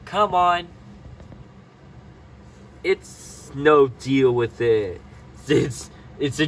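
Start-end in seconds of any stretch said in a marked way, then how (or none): none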